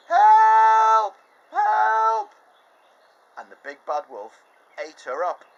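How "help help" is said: very high, loud scream for help; shout for help. Acted. Girl being eaten, screaming for help. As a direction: cry out in panic.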